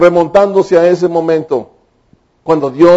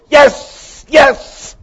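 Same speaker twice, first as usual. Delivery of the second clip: triumphant yes; 9 semitones higher than usual. Acted. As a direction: cheer with arms raised. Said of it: "levantaron los brazos, dijeron".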